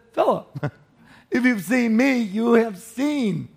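high, loud, drawn-out insistence; emphatic exasperated answer. Acted. Acting out Jesus answering Philip.

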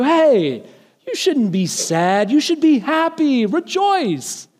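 bright, high, upbeat rejoice; chirpy, high reassurance, climbing to rejoice; a departing relative cheerily urging happiness, climbing high; mock cheerful farewell. Departing loved one cheerfully consoling.

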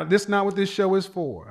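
ordinary talk, nothing marked

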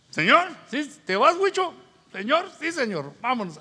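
high, loud mock dialogue of call and answer; hammed-up eager recruit; eager acted exchange. Acts out eager call from God.

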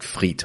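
"frite" with a throaty French R; "frite" in proper French, uvular R. Shown as a demonstration. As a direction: say "frite" softly, R made in the throat. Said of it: "to say the French R".

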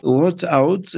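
slow, drawn-out English in a French accent; poor English accent. Real, not acted. Guest repeating Trump's term after the clip, poor English accent. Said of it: "désolé pour l'accent anglais".

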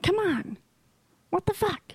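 lightly voiced Jersey driver; impression of angry driver. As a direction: imitate her cursing at traffic. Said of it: "And then you're like".

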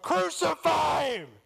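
very loud, high, drawn-out roar. Acted. Crowd's response to Pilate, full roaring shout. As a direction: roar it out slowly as a whole mob. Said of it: "An even louder roar of".

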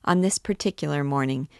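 usual speaking voice

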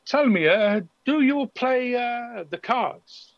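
put-on light accent, enticing the elf to play; lightly accented card sharp. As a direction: coax the elf like a smooth gambler.

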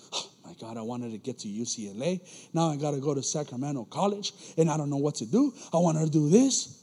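low, subdued grumble that builds toward the end; mock complaining.

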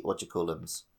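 ordinary talk

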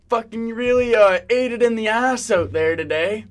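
loud, high mock taunt; fumbling heckler. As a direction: heckle loudly while groping for the insult. Acting out a lame heckler.

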